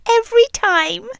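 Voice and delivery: ordinary talk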